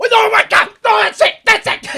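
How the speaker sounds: high, loud mock shout; fed-up outburst. Larry calling off the experiment, shouting in exasperation. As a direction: explode like someone who is completely done. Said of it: "He was done".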